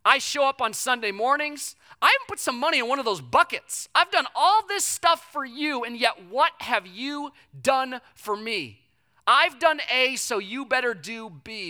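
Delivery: high, loud entitled whine at God; self-righteous complaint. Retold or imitated. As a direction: demand payback from God. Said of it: "We say".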